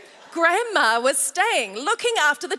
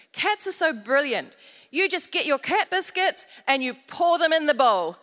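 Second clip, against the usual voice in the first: normal talking voice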